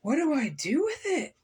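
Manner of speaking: Gus's bewildered outcry, lightly voiced; mild cry of dismay. A narrator's reading. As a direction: cry out the question with a swooping pitch. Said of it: "Gus cried".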